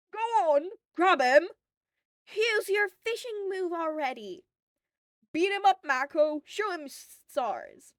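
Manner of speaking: high, very loud cheering from the crowd; crowd yelling. A narrator's reading. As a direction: scream it at the fighters like a rowdy fan.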